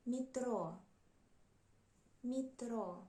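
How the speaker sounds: метро said "mi-TRO", stress at the end; unstressed Е as И. Shown as a demonstration. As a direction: turn the Е of метро into a short "i". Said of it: "we pronounce it like И".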